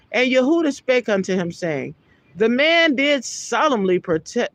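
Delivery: scripture reading. Acted. Preacher reading Genesis aloud to his listeners, solemn. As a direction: read the verse out with solemn weight.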